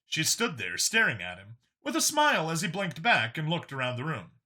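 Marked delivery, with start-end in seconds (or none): none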